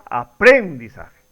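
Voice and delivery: "aprendizaje" stressed on PREN, aPRÉNdizaje; "aprendizaje" accented on the second syllable, PREN; misplaced stress on PREN. Shown as a demonstration. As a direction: hit PREN in "aprendizaje", then let the rest fall. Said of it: "O la pronunciación correcta sería".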